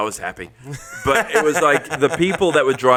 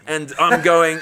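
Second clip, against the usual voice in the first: normal talking voice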